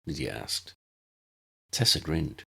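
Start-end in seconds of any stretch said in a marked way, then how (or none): none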